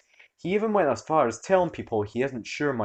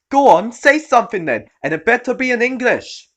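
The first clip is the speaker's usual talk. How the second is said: angry shrill demand; 13 semitones higher, 12 dB louder than usual. Acted. Entitled mum demanding.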